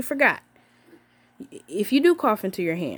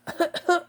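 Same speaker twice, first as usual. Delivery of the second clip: demo cough; 9 semitones higher than usual. Shown as a demonstration. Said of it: "If you have to cough".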